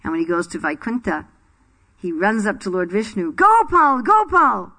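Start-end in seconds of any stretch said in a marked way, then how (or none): 3.3-4.7 high, loud joyful call; eager calling out. Retold or imitated. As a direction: run up calling his name with delight. Runs up calling Lord Vishnu.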